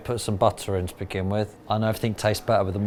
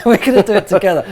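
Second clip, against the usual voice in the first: laughing; 9 semitones higher, 10 dB louder, faster than usual. Real, not acted. Laughs while introducing cooking.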